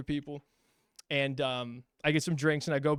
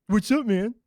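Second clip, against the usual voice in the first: higher, taunting parody of a greeting; mocking echo; 5 semitones higher than usual. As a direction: throw the greeting back as mockery. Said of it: "You were mocking me".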